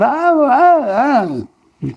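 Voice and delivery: mock anguished oh oh oh; mock wailing. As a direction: wail the oh's like someone in despair. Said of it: "people scream out".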